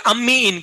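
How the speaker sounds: अम्मी इन split in two, high and loud; pronunciation demo. Shown as a demonstration. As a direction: sound out अम्मी इन as two words, punched. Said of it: "कैसे pronounce करेंगे मैं बताता हूँ". (अम्मी इन को दो हिस्सों में, ज़ोर से बोलकर सिखाना)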